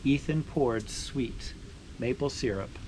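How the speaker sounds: slowing, softening read-aloud ending; story narration. Acted. Finishing the sugaring story for viewers, gentle storytelling close.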